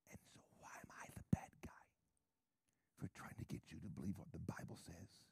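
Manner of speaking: hushed, breathy whisper about money. Acted. Preacher whispering to the congregation about money. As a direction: drop to a secret whisper.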